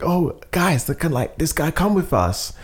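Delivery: imitating an eager friendly guy; eager invite. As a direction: sweep her along with you. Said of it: "He's like".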